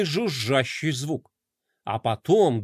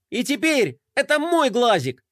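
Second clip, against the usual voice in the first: proud; 7 semitones higher than usual. A narrator's reading. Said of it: "гордо заявил Флай".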